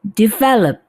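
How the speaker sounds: Develop said as "divelop", stress on the second syllable; correct pronunciation. Shown as a demonstration. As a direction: model Develop carefully as "di-VEL-op". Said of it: "Bagaimana kita mengucapkannya?".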